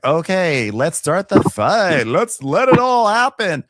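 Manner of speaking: high, drawn-out rallying call; rally the troops in a put-on voice; slow, climbing cheer to start things; mock battle cry. Acting out the decision to fight.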